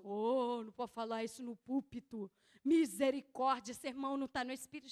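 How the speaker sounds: mock pious disapproval, pitched low; religious churchgoer. Preacher mimicking a religious church objecting to the pulpit talk, mock disapproval. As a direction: imitate a scandalized religious churchgoer. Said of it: "igreja religiosa".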